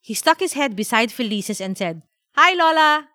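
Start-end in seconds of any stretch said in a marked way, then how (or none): 2.3-3.1 put-on high, loud boy's hello; eager kid shouting into the receiver; child's greeting. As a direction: call out to grandma on the phone. Boy calling into the phone.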